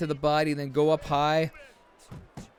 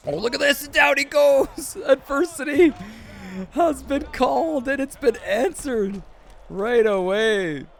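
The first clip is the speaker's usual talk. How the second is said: excited knockdown call; 11 semitones higher, 4 dB louder than usual. Real, not acted. Commentator reacting live to a sudden knockdown, excited. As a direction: erupt into a high-pitched play-by-play cry.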